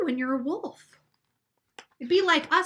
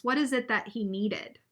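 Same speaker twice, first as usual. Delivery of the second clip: ordinary delivery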